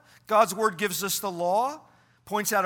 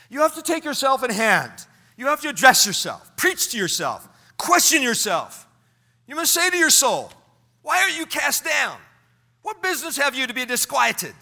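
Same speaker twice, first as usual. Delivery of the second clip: raised, emphatic exhortation; impassioned quote reading; 7 semitones higher, 6 dB louder than usual. Acted. Pastor reading a favorite quote on self-counseling, exhorting. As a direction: drive each command home firmly. Reads quote with preaching force.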